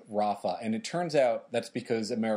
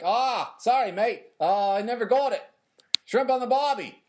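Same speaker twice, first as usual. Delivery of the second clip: loud, high, hammed-up Australian; mock Australian accent; 10 semitones higher, 5 dB louder than usual. Joking as an Australian who missed the war invite, mock Australian accent. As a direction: put on a bad Aussie accent that slips toward Boston. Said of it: "That was a little, like, Bostonian".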